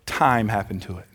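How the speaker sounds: low punchline. Real, not acted. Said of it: "repeated punchline, said low".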